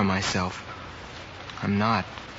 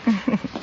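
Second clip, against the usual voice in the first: short laugh; 10 semitones higher than usual. Acted. Fred laughs at Sue's reply.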